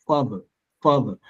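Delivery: father falling from strong FA to weak "ther"; stress on first syllable. Shown as a demonstration. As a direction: repeat father, weight on FA. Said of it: "it's louder".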